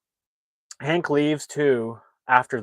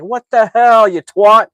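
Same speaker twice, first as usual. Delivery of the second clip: much higher and louder, a hit-in-the-head shout; outraged yell; 11 semitones higher, 14 dB louder, slower than usual. Retold or imitated. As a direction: yell it like you just got clubbed. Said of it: "he's like".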